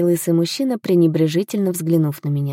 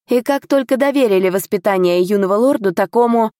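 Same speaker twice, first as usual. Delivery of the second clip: raised-pitch, self-important boast; haughty; 4 semitones higher, 3 dB louder than usual. A narrator's reading. Tutor boasts of the count's favour, haughty. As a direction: puff up with importance. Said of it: "высоко задрал нос тот".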